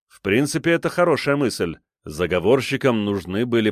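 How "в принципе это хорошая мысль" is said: ordinary delivery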